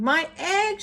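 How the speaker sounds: high, excited cry; shouted. Acted. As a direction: cry out in amazement. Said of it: "shouted Horton".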